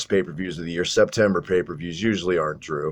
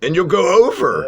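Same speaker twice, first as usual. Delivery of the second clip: loud, high-pitched hyped-up exclamation; exclaiming; 11 semitones higher, 6 dB louder than usual. Real, not acted. Podcast host capping an absurd wrestling storyline, exclaiming.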